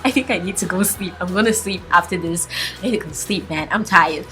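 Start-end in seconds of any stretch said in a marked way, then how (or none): none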